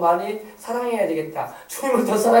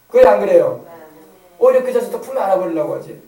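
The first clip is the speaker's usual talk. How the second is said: usual speaking voice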